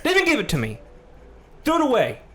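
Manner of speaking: loud, high, outraged disbelief; furious rant. Real, not acted. Ranting to a friend about the clerk, furious.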